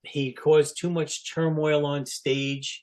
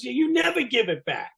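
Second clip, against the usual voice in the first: high, whiny complaint; imitating the guitar guy griping; complaining quote; 10 semitones higher than usual. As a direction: needle him about never paying back. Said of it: "He goes".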